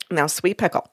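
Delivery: low, soft, lightly voiced dad; father's gentle admonishing. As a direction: say it as a kindly warning, voice dropped. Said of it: "it's the way he says it".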